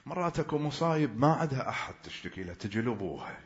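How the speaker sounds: usual speaking voice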